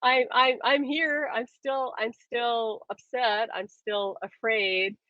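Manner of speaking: a pleading emotion calling high, then softening; voice of your emotions. Acted. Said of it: "your emotions are simply saying".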